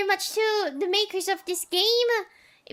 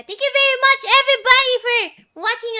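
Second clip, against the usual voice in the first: excited; 6 semitones higher, 8 dB louder, faster than usual. Real, not acted. Excited end-of-stream thanks.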